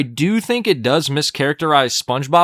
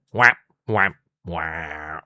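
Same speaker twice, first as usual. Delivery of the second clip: sound effect; 10 semitones lower, 5 dB quieter, much slower than usual. Retold or imitated. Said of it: "Like that one dude".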